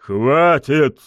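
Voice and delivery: loud, high, abrupt roar; barked order. A narrator's reading. Pushkin silences the taunting lady, barked. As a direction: cut her off with a bark. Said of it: "рявкнул Пушкин".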